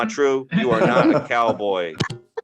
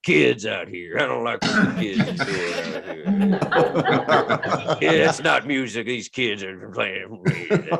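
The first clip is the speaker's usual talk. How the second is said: put-on slow grumbling old-man voice; mock cranky grump; a hint of a grouchy old-timer; grumpy old man; slower than usual. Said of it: "I was just like".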